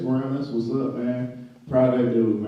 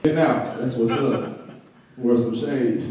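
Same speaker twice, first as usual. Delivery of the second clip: ordinary talk, nothing marked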